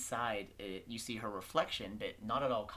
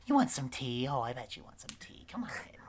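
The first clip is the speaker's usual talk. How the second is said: gushing, hospitable witch; 12 semitones higher, 8 dB louder than usual. Acted. Witch inviting little visitor in for tea, doting coo.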